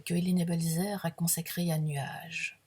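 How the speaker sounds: normal talking voice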